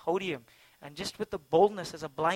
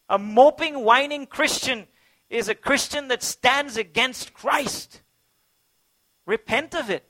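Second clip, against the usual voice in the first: loud and higher, pressing sermon cadence; fervent sermon climax, loud and high; impassioned preaching; 8 semitones higher, 11 dB louder than usual. Acted. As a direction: preach it with rising fervour. Preaching continues.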